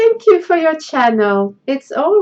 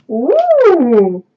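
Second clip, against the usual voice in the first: ordinary talk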